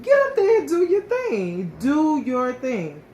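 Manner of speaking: high, loud urging push; cheering husband. Retold or imitated. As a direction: pump her up like a proud husband. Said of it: "He said".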